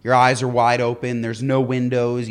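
usual speaking voice

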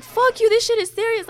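high, loud furious snap; angry outburst. Retold or imitated. Injured girl yelling at boyfriend who says calm down, high and loud. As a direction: yell it at him, high and loud.

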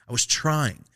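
ordinary talk, nothing marked

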